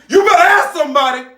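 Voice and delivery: high, loud brag; telling off the chef, loud and cocky; defiant retort. Retold or imitated. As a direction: belt out the comeback. Punchline retort told to chef.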